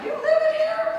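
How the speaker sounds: squeaky high shocked question; shocked disbelief. Retold or imitated. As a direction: sound appalled at the place. Friend shocked at her apartment.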